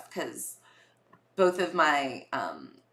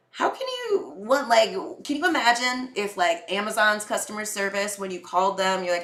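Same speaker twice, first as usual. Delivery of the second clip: ordinary delivery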